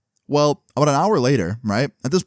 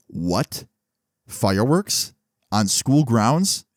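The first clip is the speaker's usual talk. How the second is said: incredulous questions; much slower than usual. Retold or imitated. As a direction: separate rising questions, slowing down. Principal retold shocked by report.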